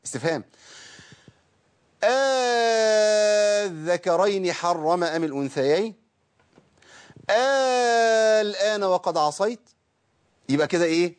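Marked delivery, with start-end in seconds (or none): 2.0-5.9 آه الله أذن لكم slow, opening stretched into a long alif; ibdal with long madd. Shown as a demonstration. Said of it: "لو أبدلتها بألف". 7.3-9.6 آه الله أذن لكم slow, opening stretched into a long alif; ibdal with long madd. Shown as a demonstration. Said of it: "أنا عملت كده ... إبدال".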